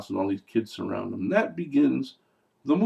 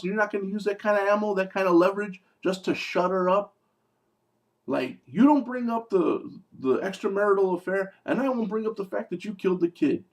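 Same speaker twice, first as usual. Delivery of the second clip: much higher, worked-up disbelief; exasperated rant; 8 semitones higher than usual. Real, not acted. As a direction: pitch climbing as he rants. Ranting at character's choices.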